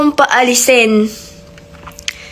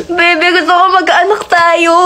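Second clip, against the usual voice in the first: sweet plea; 6 semitones higher than usual. Pretend. Sweet talk to partner.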